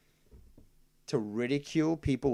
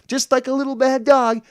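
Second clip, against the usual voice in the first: sharp loud burst; 11 semitones higher, 11 dB louder than usual. Real, not acted. Sudden high loud burst.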